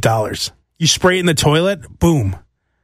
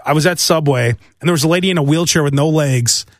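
ordinary delivery

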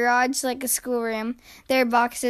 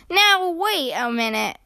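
slow, drawn-out objection; mildly indignant child's protest; 2 semitones higher, slower than usual. A narrator's reading. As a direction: object firmly, stretching the words. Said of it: "protested Sister".

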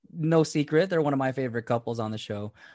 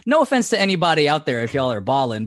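ordinary talk, nothing marked

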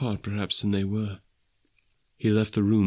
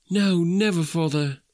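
higher, earnest exclamation; children crying out; 8 semitones higher than usual. Acted. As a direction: promise father eagerly, pitched up. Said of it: "they all cried again".

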